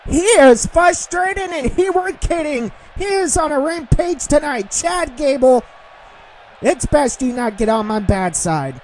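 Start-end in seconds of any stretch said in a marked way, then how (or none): none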